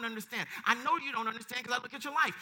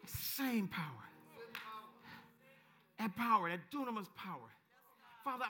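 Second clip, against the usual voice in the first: lower, softer praying voice; closing prayer; 3 semitones lower, 6 dB quieter than usual. Real, not acted. Preacher praying for the people after the climax, subdued and low. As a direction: come down from the shouting into hushed prayer.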